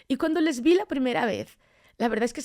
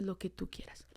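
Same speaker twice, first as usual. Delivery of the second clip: near-whispered, low retold prayer; hushed surrender; 4 semitones lower, 15 dB quieter than usual. She tells God she yields, hushed surrender. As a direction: hand it over in a soft murmur.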